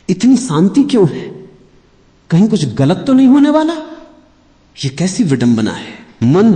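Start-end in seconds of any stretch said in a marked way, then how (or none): 0.1-3.7 rising, quickening worried voice of the mind; anxious inner voice. Retold or imitated. Teacher voicing the restless mind, rising and anxious. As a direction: let the doubt creep in and speed up. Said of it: "तो मन कहेगा".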